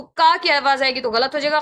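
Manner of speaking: usual speaking voice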